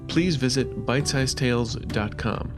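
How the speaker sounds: usual speaking voice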